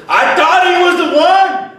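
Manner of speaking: high, loud shout of letdown; a follower shouting that he trusted Jesus; mock shout. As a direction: cry it out betrayed. Disciple's retold outburst.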